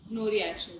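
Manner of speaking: low, quiet deadpan; understated show of non-reaction; unresponsive deadpan. Retold or imitated. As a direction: drop it softly, giving nothing away. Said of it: "These two are like".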